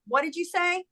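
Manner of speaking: high, soft questioning quote; lightly voiced son who misheard; puzzled son. Son calling back, puzzled.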